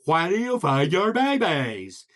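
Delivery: wide, distorted open-mouth vowels; goofy frog with exaggerated enunciation; big-mouthed frog. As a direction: stretch the mouth wide on each word. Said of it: "So the big-mouthed frog".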